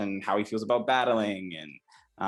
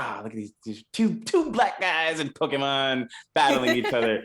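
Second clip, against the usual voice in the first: higher, louder burst of fan excitement; thrilled fan exclamation, retold; retold excited awe; 5 semitones higher, 4 dB louder than usual. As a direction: marvel out loud, building from an aside. Retold thrilled reaction to episode.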